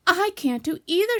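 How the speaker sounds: high, loud wail; loud, high lament; distressed realisation. Acted. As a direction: cry out that you're trapped. Pooh stuck in the hole.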